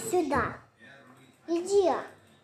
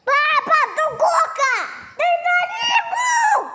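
excited shouting; 7 semitones higher than usual. Real, not acted. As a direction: yell it out, teasing dad. Ребёнок дразнит папу криком. (громкий задорный крик)

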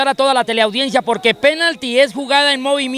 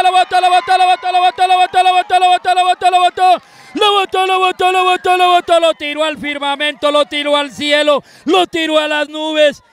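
missed penalty call; 4 semitones higher than usual. Real, not acted. Penalty missed over the bar.

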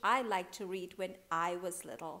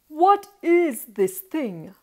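slow, loud, higher royal question; indignant king; 7 semitones higher, 18 dB louder, slower than usual. Acted. King feels a ball on his nose, indignant question. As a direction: demand it as an affronted king.

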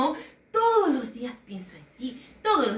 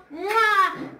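loud high smacking kiss; blown kiss; 7 semitones higher, 3 dB louder than usual. Real, not acted. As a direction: blow them a big loud kiss. Kiss to the children. (beso sonoro y agudo para los niños)

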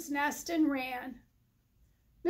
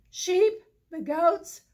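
proud announcing; 3 semitones higher, 7 dB louder, slower than usual. Acted. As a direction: present the guests proudly. Listing guests proudly.

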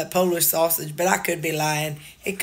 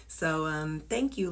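usual speaking voice

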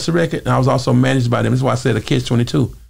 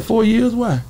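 wary question; 10 semitones higher, slower than usual. Retold or imitated. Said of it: "I said".

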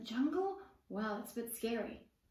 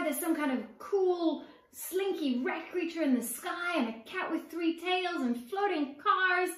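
wild excited narration; 7 semitones higher, 5 dB louder than usual. A narrator's reading.

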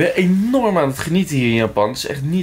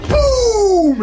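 high, loud boom; loud exclamation; 6 dB louder than usual. Real, not acted. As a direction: burst out on boom. Exclamation in the story.

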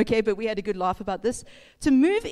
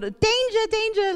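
lamb's alarm; 10 semitones higher, slower than usual. Retold or imitated. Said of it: "it probably thought".

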